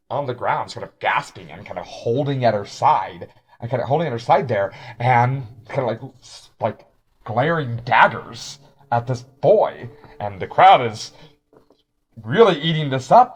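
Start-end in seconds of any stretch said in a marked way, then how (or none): none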